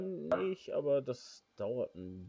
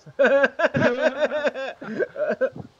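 high, loud burst of laughter; 12 semitones higher, 14 dB louder than usual. Real, not acted. Laughs at undeserved praise. (lautes Lachen)

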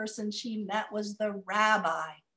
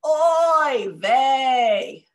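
loud, groaning oy vey; grumpy oy vey; 5 semitones higher, 12 dB louder than usual. Shown as a demonstration. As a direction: lead the crowd in a grumpy oy vey. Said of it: "can everybody just practice one time".

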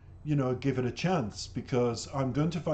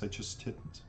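under the breath; 4 semitones lower, 11 dB quieter than usual. Real, not acted. Trailing confession after apology.

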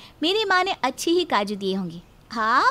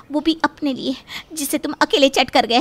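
usual speaking voice

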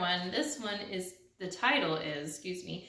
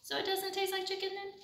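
high, quick, soft chick voice; persistent duckling; 8 semitones higher, 6 dB quieter, faster than usual. Acted. The duckling still pestering the pigeon, high and quick. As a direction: keep pestering sweetly.

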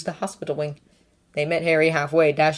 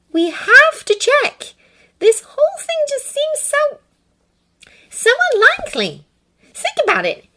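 careful Hermione; 6 dB louder than usual. A narrator's reading. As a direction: reason with him carefully. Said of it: "saying very carefully".

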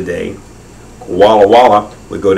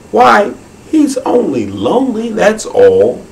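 a higher, gentle wombat voice pleading for his friend; sympathetic wombat; 11 semitones higher than usual. Acted. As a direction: stick up for the lonely koala, kindly. Willie the Wombat feeling sad.

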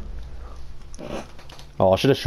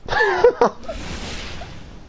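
laugh. Real, not acted. Laughing at teammate in game.

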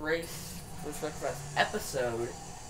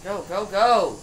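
urgent shout; 4 semitones higher, 11 dB louder than usual. Real, not acted. Urging car forward.

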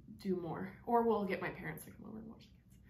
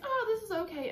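grudging approval; 13 semitones higher, 5 dB louder, faster than usual. Retold or imitated. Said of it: "I was like".

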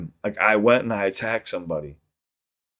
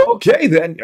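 mock Southern accent, higher and louder; 7 semitones higher, 5 dB louder, slower than usual. Imitating people from the South, hammed-up Southern accent. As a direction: put on an exaggerated Southern drawl. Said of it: "when you hear people from the South".

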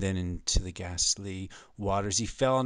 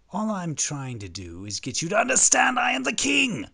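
rising to a high shout; fed-up king boiling over; agitated king; 10 semitones higher, 5 dB louder than usual. Acted. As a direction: build from exasperation to a royal yell. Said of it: "The king's pretty agitated".

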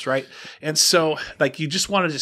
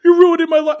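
much higher and louder, a mock wail; imitating crying; 13 semitones higher, 8 dB louder, faster than usual. Clerk wails at the filming guest, imitated in a high, loud crying voice. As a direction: cry it out like a broken man. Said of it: "He starts crying".

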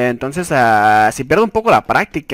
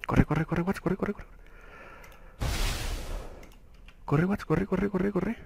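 soft, rapid repeated urging; hushed urging; 2 semitones higher, 15 dB quieter, slower than usual. Real, not acted. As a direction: mutter urgently to yourself as you run. Urges himself to run.

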